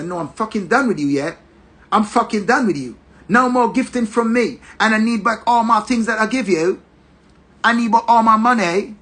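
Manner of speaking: angry girlfriend in a mock British accent; put-on foreign accent. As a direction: imitate her telling him off in British tones. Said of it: "the Yankee and the British and the every accent".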